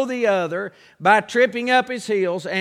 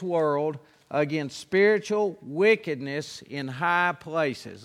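scripture reading; 4 semitones lower, 4 dB quieter than usual. Acted. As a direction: read the verses deliberately, word by word.

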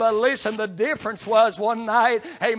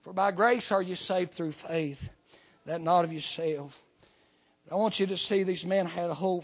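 ordinary talk, nothing marked

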